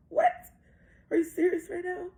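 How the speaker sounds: high, loud incredulous outburst; retold disbelief. Mom reacting to daughter wanting to stay, loud disbelief. Mother stunned by daughter's answer.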